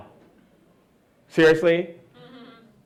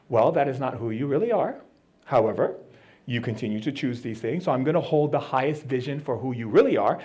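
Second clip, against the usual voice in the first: serene from the throne; 5 semitones lower, 5 dB quieter than usual. Acted. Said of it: "I just sit on my throne and I just go".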